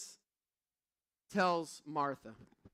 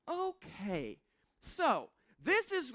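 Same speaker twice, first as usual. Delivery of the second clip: excited son; 11 semitones higher, much slower than usual. Retold or imitated. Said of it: "He's like".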